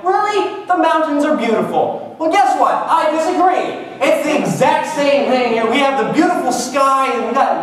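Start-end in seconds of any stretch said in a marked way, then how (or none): 0.0-2.2 high, gushing mock disbelief; mocking gushing fan. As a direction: parody people who rave about mountains. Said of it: "A lot of people are like".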